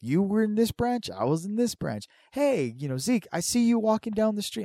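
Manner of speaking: high, eager neighborly chat; friendly bonding. Acted. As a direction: greet a newfound buddy with enthusiasm.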